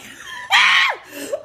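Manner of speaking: loud, very high cackle; shrieking laugh. Real, not acted. Cracking up at own tale of being caught, shrieking laugh. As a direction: burst out in a piercing laugh. Loud outburst after the why.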